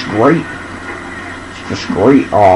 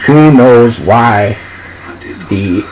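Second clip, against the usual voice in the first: slow, drawn-out dramatic poem reading; slow poem recitation; much slower than usual. Acted. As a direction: linger on every line like a bard.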